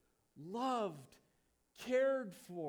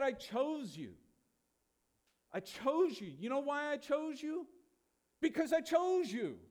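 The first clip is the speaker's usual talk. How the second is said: high, loud, emphatic declaration; raised preaching voice speaking as God; voicing God's declaration; 7 semitones higher, 4 dB louder than usual. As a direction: proclaim it insistently, building to the final line. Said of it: "did you hear the amazing logic of God's statement".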